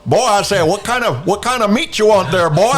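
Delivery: high, loud Southern rooster; Foghorn Leghorn. Acted. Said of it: "meld foghorn leghorn".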